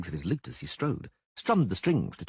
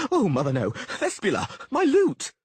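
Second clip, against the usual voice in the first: rising, louder panicked shout; panicked cry; 10 semitones higher, 6 dB louder than usual. Acted. Dandelion cries out as his lute falls from the balcony, panicked.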